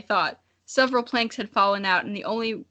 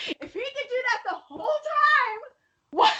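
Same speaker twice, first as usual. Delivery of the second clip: very high shout at the plot hole; disbelieving shout. Real, not acted. Reader spots a plot hole and shouts, very high. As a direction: yelp at the obvious flaw.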